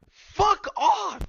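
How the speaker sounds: very high, loud, put-on voice; furious yell. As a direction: scream it in a shrill cartoon voice. Both yell at Dumbledore.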